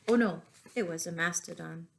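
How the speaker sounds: usual speaking voice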